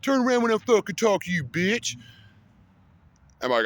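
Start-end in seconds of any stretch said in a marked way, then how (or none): 0.0-2.0 high-pitched mock drunk tirade; hammed-up angry taunt, higher but not shouted; retold cussing out. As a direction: imitate the kid cussing you out, pitched up. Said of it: "He's fucking cussing me out".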